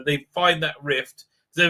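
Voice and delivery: usual speaking voice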